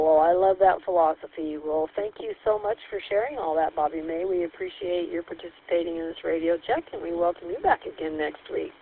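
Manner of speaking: ordinary talk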